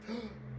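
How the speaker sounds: quick startled intake of breath; sharp gasp. Real, not acted. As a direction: gasp in surprise.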